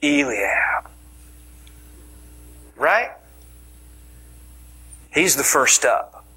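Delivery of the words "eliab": breathy swooning whisper of the name; dreamy whisper. Shown as a demonstration. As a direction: whisper Eliab like a crush. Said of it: "Just stirs images of this".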